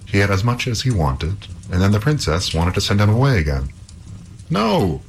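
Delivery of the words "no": high-pitched cry of refusal. A narrator's reading. Halvor refuses the princess sending him away, crying out. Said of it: "cried Halvor".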